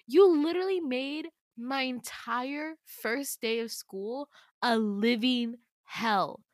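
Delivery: slow, emphatic, word-by-word anger; bitter accusation. Acted. Girl blaming Damien for her first day of school, bitter accusation. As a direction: spell out the grievance deliberately.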